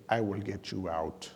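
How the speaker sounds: hammed-up robot voice breaking into a laugh; a big student rescuing him, a mild Terminator impression. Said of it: "I do a terrible Terminator impersonation".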